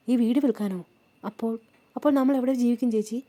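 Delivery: can't believe the house is sold; shocked question. A narrator's reading. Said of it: "Lachu ഞെട്ടലോടെ ചോദിച്ചു".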